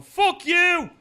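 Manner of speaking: high, loud mimicked crowd yell; mock heckler shout. Irate fan booing the fighter, mock shout. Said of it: "being that guy and going".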